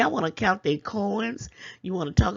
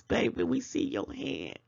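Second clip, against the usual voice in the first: normal talking voice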